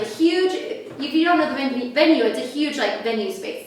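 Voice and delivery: ordinary talk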